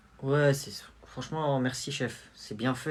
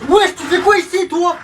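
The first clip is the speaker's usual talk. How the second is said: startled; 5 dB louder than usual. Acted. Kid startled by intruder at night.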